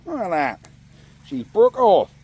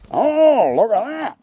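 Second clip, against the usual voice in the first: pleased exclamation; 7 semitones higher, 6 dB louder than usual. Real, not acted. Phone finally balances on trap.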